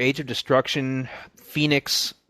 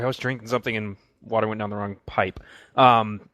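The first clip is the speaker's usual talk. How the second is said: ordinary talk, nothing marked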